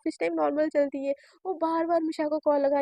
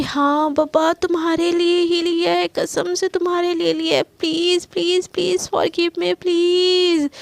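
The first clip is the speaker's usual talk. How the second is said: pleading coax; 3 semitones higher, 7 dB louder, slower than usual. Acted. Shaili pleads for forgiveness.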